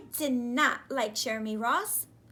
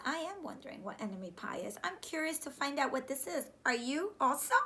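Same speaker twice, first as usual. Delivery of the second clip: fast curious aside; faster than usual. Acted. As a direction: rush it with curiosity.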